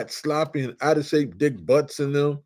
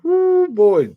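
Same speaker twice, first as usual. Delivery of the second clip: high, loud woo of delight; a fan's joyful holler; excited whoop; 14 semitones higher, 7 dB louder than usual. Real, not acted. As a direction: let out a thrilled whoop. Excited recall of rivalry.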